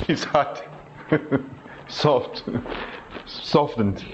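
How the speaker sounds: breathy laughing words; chuckling as he says it. Real, not acted. Laughs while teasing congregation.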